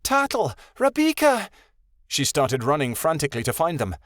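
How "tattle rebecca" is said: high, loud call into the woods. A narrator's reading. Midnight Star calls her lost friends in the forest. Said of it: "she called into the dark trees".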